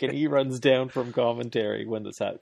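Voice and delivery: ordinary talk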